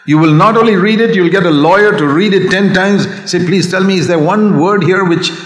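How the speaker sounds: preaching. Acted. Preaching builds to full force.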